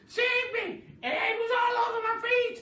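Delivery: very high, loud pleading shriek; loud frantic put-on hag voice; frantic old woman. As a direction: beg to be saved in a panic. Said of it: "Missus Twit panics".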